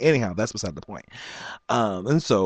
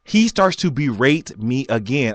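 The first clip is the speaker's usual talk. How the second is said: ordinary delivery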